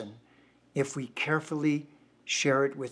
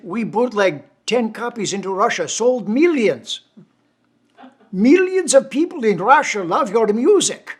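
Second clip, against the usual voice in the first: loud, much higher Russian voice, slowing on sold millions; Russian fan proudly boasting of bootlegs; excited Russian accent; 9 semitones higher, 12 dB louder than usual. Retold or imitated. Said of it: "thick Russian accent".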